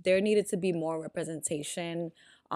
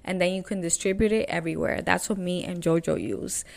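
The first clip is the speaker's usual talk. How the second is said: upbeat host ad read with punched openings; friendly podcast sponsor spot; 2 semitones higher, 5 dB louder than usual. Real, not acted. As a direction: sell it lively to the listeners. Reads a podcast platform ad.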